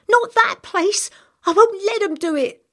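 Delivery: screaming. Acted. Girl clinging to the boy, desperate scream. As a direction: shriek the refusal. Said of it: "she screamed".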